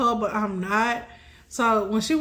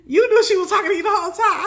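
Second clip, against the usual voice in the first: brother teasing; 5 semitones higher, 5 dB louder, faster than usual. Retold or imitated. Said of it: "My brother was like".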